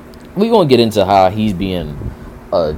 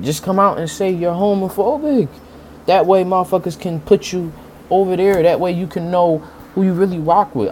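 heated; 9 semitones higher than usual. Real, not acted. Heated rant at critics.

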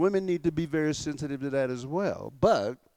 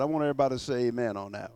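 ordinary talk

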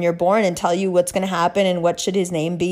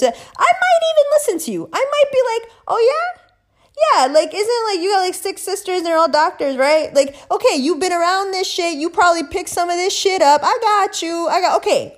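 much higher, conceding voice; indulgent listener; 9 semitones higher than usual. Acted. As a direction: play up being open to it in a high pitch. Plays herself being receptive, indulgent.

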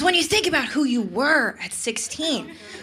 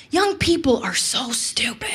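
conspiratorial whisper; hushed confiding aside. Acted. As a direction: whisper it like a secret.

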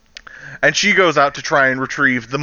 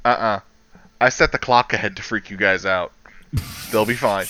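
lightly voiced low, calm boss; unruffled boss; 8 semitones lower, 4 dB quieter than usual. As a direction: drop low and matter-of-fact as the boss. Boss calmly admits his trick.

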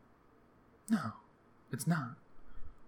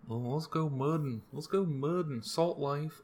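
mock small-town local; 5 semitones higher, slower than usual. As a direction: toss it off as a lightly mocked mudding fan. Mocking small-town Floridians.

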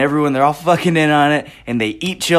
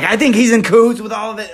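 excited realization; 9 semitones higher, 6 dB louder, faster than usual. Retold or imitated. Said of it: "And I'm like".

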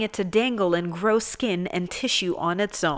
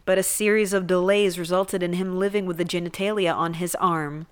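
normal talking voice

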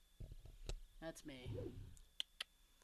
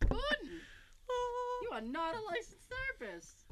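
mock scolding; 13 semitones higher than usual. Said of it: "I'm like".

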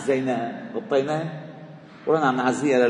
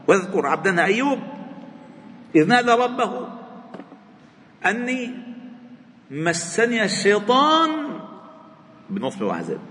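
high, lifted chanting recitation; preacher chanting a verse to the congregation; Quran recitation; 9 semitones higher than usual. Acted.

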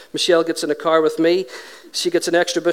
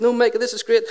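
higher-pitched insistence, retold; mock giddy enthusiasm; excited dad; 7 semitones higher than usual. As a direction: brush off the worry with glee. Acted excited reply to son.

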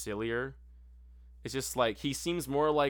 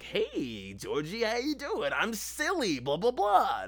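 high, goofy friendly clown; silly clown voice; 10 semitones higher, slower than usual. Retold or imitated. Praising the old clown to a friend, high goofy clown voice. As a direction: play the harmless silly clown. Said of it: "he was like".

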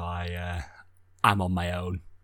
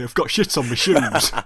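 laughing; 4 semitones higher, 4 dB louder than usual. Real, not acted. Stepped in dog mess, laughing.

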